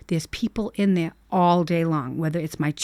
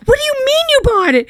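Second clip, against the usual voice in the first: high, fast, loud disbelief; rattled off in outrage; incredulous; 6 dB louder, faster than usual. Retold or imitated. Mother disbelieving that daughter bought the dog, fast high outrage.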